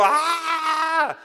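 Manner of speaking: high, shrill-pitched mock shriek of joy; retold shout. Preacher imitating the woman's cry of blessing, high mock shriek. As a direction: let out a sudden high cry. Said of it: "pero así gritó".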